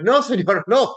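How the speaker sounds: high, loud hasty denial; mock protest. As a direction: imitate a student protesting to the teacher. Student's panicked denial to teacher, acted.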